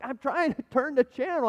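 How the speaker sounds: high-pitched defensive husband retort; flustered protest. Retold or imitated. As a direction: protest back to the wife, harried. Flustered husband answers scolding wife.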